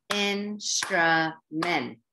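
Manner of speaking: 'Instrument' slowly in three separate beats, in-stru-ment; clapped-out syllables. Shown as a demonstration. Teacher clapping out syllables for readers, slow and separated. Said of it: "you may have clapped it out".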